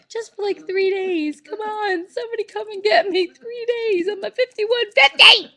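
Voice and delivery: high, loud, half-laughing cry for help; mock hysterical plea. Real, not acted. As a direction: beg to be taken away, hammed up.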